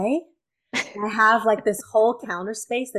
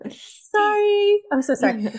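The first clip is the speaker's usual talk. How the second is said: apologizing too much; 13 semitones higher, 5 dB louder, slower than usual. Real, not acted. Son running upstairs.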